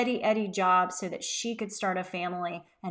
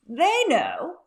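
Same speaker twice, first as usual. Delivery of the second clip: mock confident; 7 semitones higher, 7 dB louder than usual. Shown as a demonstration. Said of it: "My team understands expectations".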